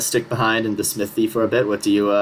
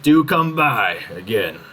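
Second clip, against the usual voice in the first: shopkeeper voice; slower than usual. Acted. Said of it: "he goes".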